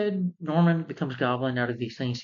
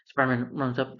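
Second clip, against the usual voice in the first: normal talking voice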